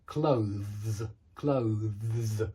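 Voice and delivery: "clothes" low with buzzing z ending; low careful demo word; clear clothes. Shown as a demonstration. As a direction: end "clothes" on a long deep z. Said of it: "voicing a kind of deep z at the end".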